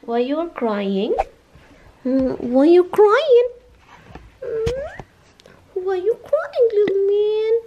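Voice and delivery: high, drawn-out cooing question. Real, not acted. Parent asking crying little man what's wrong, baby talk.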